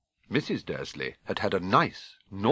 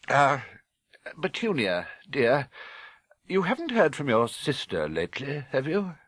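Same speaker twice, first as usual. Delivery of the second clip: higher, hesitant and nervous question; husband nervously raising a touchy subject; 6 semitones higher than usual. Acted. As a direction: ask warily, bracing for trouble. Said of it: "cleared his throat nervously".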